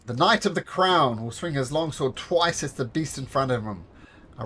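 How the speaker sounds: ordinary talk, nothing marked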